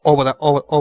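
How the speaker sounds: ordinary talk, nothing marked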